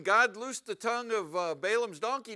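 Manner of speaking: ordinary delivery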